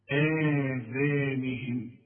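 آذانهم stretched very slowly with long vowels; slow Quranic reading of آذانهم; drawn-out recitation. Shown as a demonstration. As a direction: draw out آذانهم slowly, held madd. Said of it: "خد حكم سريع كده".

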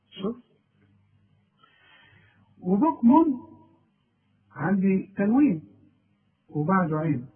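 ordinary talk, nothing marked

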